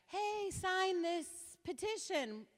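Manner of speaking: high, bright-eager mock petitioner; imitating a chirpy young canvasser; mock eager young man. Speaker mimicking the young petitioner, high and eager.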